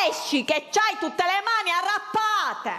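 put-on mother shouting her child out of the water; mock yelling mother. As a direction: yell it like a mom on the beach.

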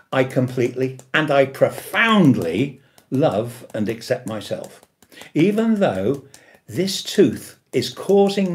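strong emphasis on "Even though", pushed out loud; emphatic affirmation. Shown as a demonstration. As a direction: stress "Even though" hard, then "completely" and "profoundly" as much. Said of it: "you have to have the emphasis on the first part".

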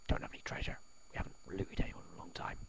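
hushed confession; whispering. Acted. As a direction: whisper it like a secret. Confessing no treasure.

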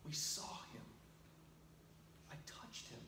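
breathless whisper of wonder; awed whisper. Pretend. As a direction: whisper it as if you can't believe it yourself. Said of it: "I can imagine Mary and Mary saying".

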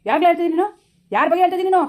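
high, loud angry demand; outraged shout at the accuser; furious challenge. Acted. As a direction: demand who she means, shouting.

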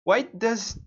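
high-pitched, loud protest; exasperated complaint. Real, not acted. Learner griping about a too-fast recording, exasperated. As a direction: whine it high and loud, in disbelief.

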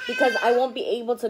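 muffled behind hand; speaking through covered mouth; hand over mouth. Real, not acted. Seen 2: Hand covers mouth from 0.1 to 1.1, then lowered to gesture.

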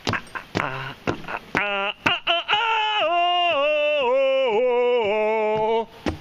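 rising laughing yelps that climb high, then ease down; ah and oh yelps, going up then down; dog walker caught off guard, high yelps then easing off; startled cries. Real, not acted.

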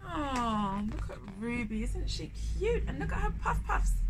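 squealing with delight at a cute picture; delighted gushing. Real, not acted. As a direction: coo over the picture excitedly.

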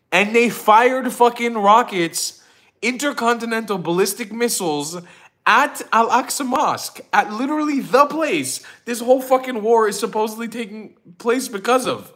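exasperated disbelief; incredulous rant. Real, not acted. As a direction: lay out the absurdity. Ranting about the irony.

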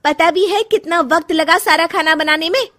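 loud, high, defiant snapping at the mother-in-law; angry retort. Acted. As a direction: talk back hotly. Daughter-in-law quarrels with mother-in-law. (गुस्से में बहू सास को जवाब देती है)

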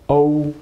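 "o" held high and level, mouth slightly open; rounded vowel demo. Shown as a demonstration. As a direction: draw out a clear, high "o". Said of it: "mouth big a little".

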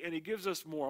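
ordinary delivery

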